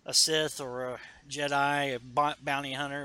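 ordinary delivery

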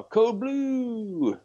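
high, sustained mock emergency call; alarm call. Cyclops summoning the X-Men, high acted alarm call. Hero calling team for help.

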